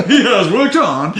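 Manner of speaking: announcer voice collapsing into laughter; laughing through it. Pretend. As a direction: crack up mid-announcement. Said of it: "I'm still doing the boxing announcer voice".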